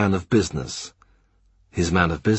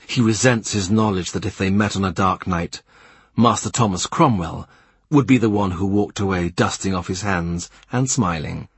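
plain reading voice

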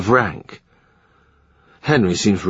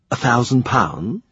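ordinary delivery, nothing marked

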